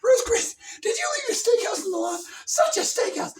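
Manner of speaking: high, loud hammed-up scold; mock mother scolding the lawn mess; scolding mom. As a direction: nag her like a fussy mother. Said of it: "She's like".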